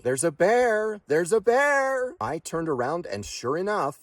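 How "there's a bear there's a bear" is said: high, loud alarmed shout, drawn out on bear; shouting a warning. A narrator's reading. Bystander yelling behind the family about a bear, alarmed shout. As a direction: call out at the top of your voice. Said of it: "someone shouting behind me".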